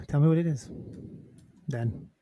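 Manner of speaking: normal talking voice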